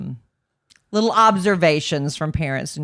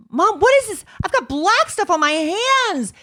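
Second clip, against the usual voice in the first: put-on whiny kid voice; 13 semitones higher than usual. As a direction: play a puzzled little boy. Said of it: "some accent that's like a Brooklyn accent".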